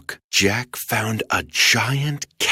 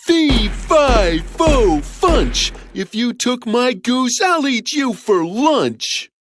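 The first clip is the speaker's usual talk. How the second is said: booming giant; 11 semitones higher, slower than usual. Acted. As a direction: threaten the thief in rhyme. Giant threatens the thief.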